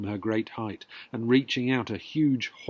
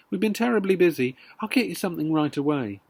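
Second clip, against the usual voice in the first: hurried apology; 2 semitones higher, faster than usual. Acted. Mrs Cranky hurries to placate.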